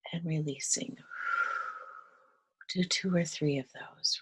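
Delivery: unhurried breath-led guidance, softening as it settles; guided meditation. Acted. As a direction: gently guide them through breathing, leaving long pauses.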